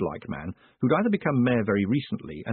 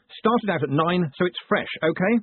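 higher-pitched, testy growl; 8 semitones higher than usual. A narrator's reading. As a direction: growl it at the clerk, voice pushed up. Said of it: "he growled".

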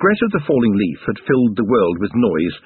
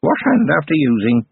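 offhand; 5 semitones higher than usual. A narrator's reading. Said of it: "in an offhand voice".

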